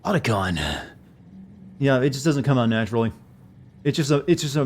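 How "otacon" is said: Snake voice. Pretend. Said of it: "it sounds like a five-year-old trying to speak like an adult".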